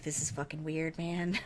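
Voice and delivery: hushed at the silent house; quiet disbelief. Retold or imitated. Mom alone in the quiet house, softly baffled.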